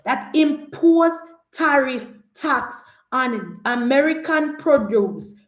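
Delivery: slow, weighty reading of the President's words; measured, slowed-down quote of the President; lightly voiced stern President quoting the tariff threat; slow, deliberate quote. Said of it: "President Trump said".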